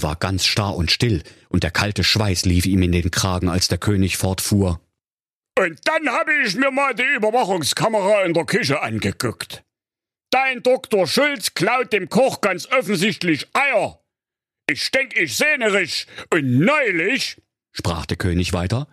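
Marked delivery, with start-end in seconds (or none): 5.6-17.4 put-on a puzzled old king raising a complaint. A narrator's reading. King complains about the dog.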